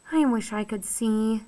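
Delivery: soft, sad wish. A narrator's reading. William Spiver lamenting his blindness to Tootie, soft and sad. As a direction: let it sink, quietly sad. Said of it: "said William Spiver in a sad voice".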